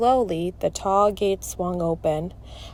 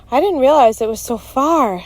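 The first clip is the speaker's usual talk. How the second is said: lightly acted out-of-breath complaint; higher, louder, as if winded from pedaling; a hint of catching the breath; panting; 6 semitones higher, 6 dB louder than usual. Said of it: "Liza panted, trying to catch her breath".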